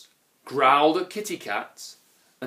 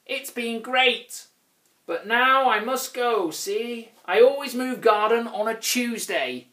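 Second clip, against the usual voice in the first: high-pitched troll farewell; resigned little troll; 9 semitones higher, 3 dB louder than usual. A narrator's reading. Grouch tells Grunty he must go, high and resigned. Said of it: "he sighed".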